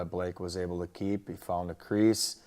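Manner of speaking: usual speaking voice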